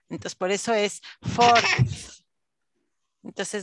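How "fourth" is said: fourth closing on a th between the teeth; fourth, not fours; th ending. Shown as a demonstration. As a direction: bite the tongue lightly for the th in fourth. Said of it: "suena como th".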